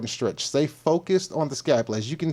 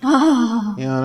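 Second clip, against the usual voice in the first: mock sigh of relief; 10 semitones higher, 6 dB louder, much slower than usual. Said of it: "she's gonna be like".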